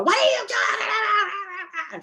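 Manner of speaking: imitating someone yelling in anger. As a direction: bellow it in outrage. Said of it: "Somebody might yell and go".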